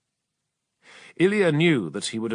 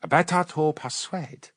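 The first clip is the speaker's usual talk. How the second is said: cool persuasive witch; 5 semitones higher than usual. Acted. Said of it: "said the witch".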